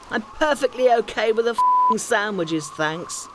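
a hint of a grumbling husband, high and loud; grumpy husband. [name] voiced grumbling.

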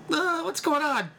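high, slow bewildered question; baffled. Retold or imitated. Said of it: "I'm like".

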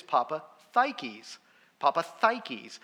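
Papa Thikes, TH in place of D; correct Greek pronunciation. Retold or imitated. Dad revealing the correct name to his son, Greek TH pronunciation. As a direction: say Papa Thikes as papa-THIGH-keys. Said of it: "the correct way to pronounce it".

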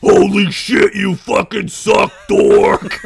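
loud slow jeering shout; pirate yelling. Acted. Pirate heckling the bard from the crowd, loud jeering shout. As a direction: bellow an insult at the stage. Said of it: "yelled the pirate guy".

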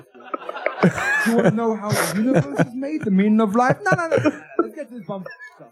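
high, laughing put-on voice; laughing mock British. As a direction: giggle through a squeaky parody. Mocking British bit, laughing.

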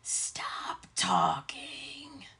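breathy ghostly whisper, very slow; eerie slow whisper of a phantom voice; creepy whisper. Retold or imitated. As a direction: whisper it like a voice from nowhere. Eerie voice heard on podcast.

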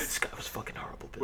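whisper. Pretend. Mock NFL insiders gossiping.

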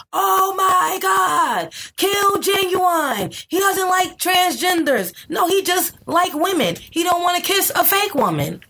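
high, slow hammed-up shout of outrage; mock outraged crowd. Mocking online outrage at Genuine, shrill high slow shout. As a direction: wail it like a scandalized mob. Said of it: "we gotta".

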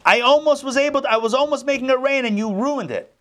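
high, loud furious rant; retold angry man yelling at his helpers; angry outburst. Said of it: "the man is so angry".

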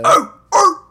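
high, loud rapper ad-lib barks; punchy mock rapper yelps. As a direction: bark it out like a hype rapper. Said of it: "D M X would be like".

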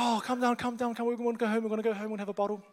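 put-on high, singled-pitch hushing of a baby; high-voiced, pleading comfort, played for laughs; mock soothing. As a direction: act out a frantic parent calming a crying baby. Acts out soothing his crying baby.

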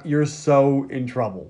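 low, slow warning; ominous drawl. Retold or imitated. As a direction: stretch it out ominously. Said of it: "And they're like".

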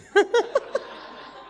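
a sudden airy giggle up high; high breathy giggle. Real, not acted. Laughing at her own joke.

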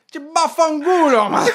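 high, loud Italian curse at a driver; yelling in Italian. Retold or imitated. Runner yells at driver who honked, loud Italian curse. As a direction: hurl it at him like an angry Italian. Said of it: "I started yelling at him in Italian".

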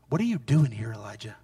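low, near-whispered question; hushed voice of God. Acted. Voice speaking to Elijah at the cave, hushed and low. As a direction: ask it very low, trailing almost to nothing. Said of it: "Then the voice said to him again".

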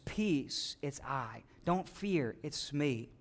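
soft, low, calming reassurance; gentle Jesus voice. Acted. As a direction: speak peace softly to frightened men. Jesus voiced calmly in sermon.

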